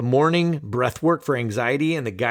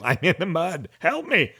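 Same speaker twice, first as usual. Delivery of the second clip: much higher, playful cry for help; mock plea; 4 semitones higher than usual. Host jokingly pleads with listeners from the mud, high mock plea. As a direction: beg in jest.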